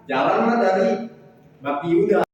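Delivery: normal talking voice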